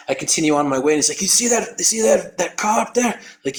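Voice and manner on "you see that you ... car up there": high-pitched Australian pointing something out; lightly imitated Aussie coach; Australian accent. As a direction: urge them on in an Australian lilt. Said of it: "You're Australian?".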